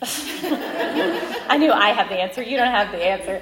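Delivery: grumbling through real laughter; teasing jab at her husband, laughing; laughing grumble. Real, not acted. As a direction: laugh while griping at him. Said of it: "I kinda grumbled at him".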